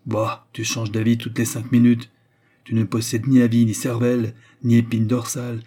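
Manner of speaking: loud, contemptuous ringing outburst. Acted. The brother at the window berates Albert, loud and ringing. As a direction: blast him with scorn. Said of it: "dit d'une voix éclatante".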